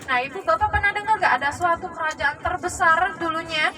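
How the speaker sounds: ordinary talk, nothing marked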